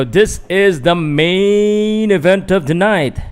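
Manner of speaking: practising a big fight introduction; ring announcer. Pretend. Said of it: "trying to practice".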